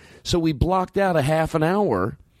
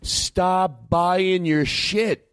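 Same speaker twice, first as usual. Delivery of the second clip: very slow, every word weighted; slow emphatic; 5 semitones higher, much slower than usual. Real, not acted. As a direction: spell it out, slowly. Earnest plea to audience.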